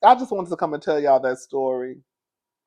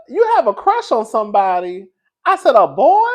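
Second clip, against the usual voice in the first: higher, louder shocked questions; surprised; 7 semitones higher, 7 dB louder than usual. Retold or imitated. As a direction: gasp it out in surprise.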